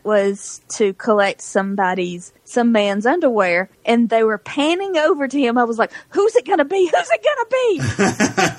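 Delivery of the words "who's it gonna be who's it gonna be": excited guessing. Retold or imitated. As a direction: build the suspense like a game show. Teasing guess while camera pans.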